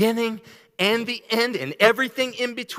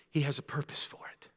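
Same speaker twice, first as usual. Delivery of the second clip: whispered; 5 semitones lower, 14 dB quieter than usual. Acted. Whispered line in sermon.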